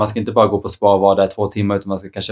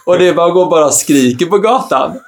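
laughing; 8 semitones higher, 5 dB louder than usual. Real, not acted. Laughing rant about rain.

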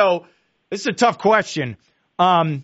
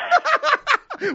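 high burst of laughter. Real, not acted. Laughing at Scott Van Pelt joke.

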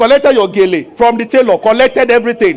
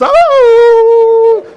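mock wail; 12 semitones higher, 6 dB louder than usual. Mourner wailing at the door.

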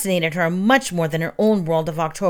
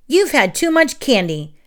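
mild yell; 6 semitones higher, 3 dB louder than usual. A narrator's reading. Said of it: "yelled the same thing at him".

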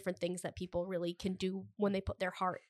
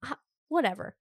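usual speaking voice

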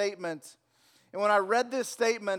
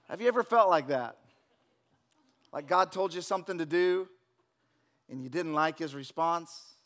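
usual speaking voice

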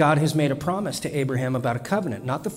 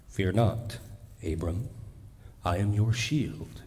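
lower, softer and slow, like a comforting father; gentle voice of God; 4 semitones lower, 6 dB quieter than usual. Shown as a demonstration. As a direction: speak low and tender to reassure. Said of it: "understand the back and forth here".

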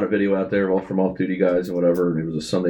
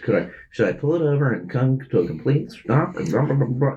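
exaggerated cartoon intonation; 4 semitones higher than usual. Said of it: "Why are you talking like that?".

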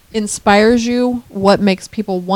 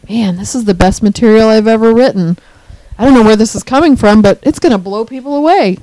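louder, thrilled self-quote rising at the end; excited boasting; 2 semitones higher, 6 dB louder than usual. Retold or imitated. Writer hyping their own new material to themselves, excited. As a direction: sell it with growing excitement. Acting out his writing highs.

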